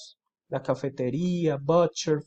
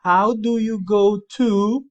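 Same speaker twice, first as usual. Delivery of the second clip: the English question said slowly, louder and higher; slow louder model question; 5 semitones higher, 7 dB louder, much slower than usual. Shown as a demonstration. Teacher to students, presenting the question slowly and louder. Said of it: "alguien nos pregunta".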